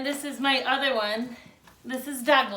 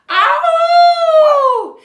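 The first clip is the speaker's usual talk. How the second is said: very high loud howl; dog howl; 21 dB louder than usual. Acted. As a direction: howl as loud as the lungs let. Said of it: "he woofed and howled".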